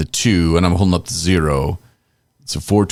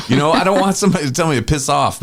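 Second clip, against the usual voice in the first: ordinary talk, nothing marked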